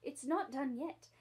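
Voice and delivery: ordinary delivery, nothing marked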